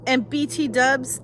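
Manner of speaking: ordinary talk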